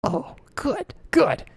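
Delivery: high, hushed whisper; secretive, breathy approval; whispered relief. Acted. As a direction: whisper it like a conspirator. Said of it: "and they whisper".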